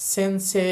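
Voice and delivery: সেনসে ending on a long এ; Japanese pronunciation. Shown as a demonstration. Teacher repeating the native form. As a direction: stretch the সে, no final ই. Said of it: "জাপানিজদের মতো উচ্চারণ করতে চাইলে".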